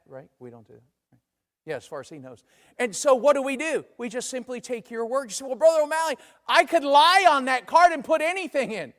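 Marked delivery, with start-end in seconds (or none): 5.5-8.9 loud, high-pitched mock objection; hammed-up protest, voice pitched up; cheeky challenge. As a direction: play a member gleefully challenging the preacher. Voicing a member's cheeky challenge.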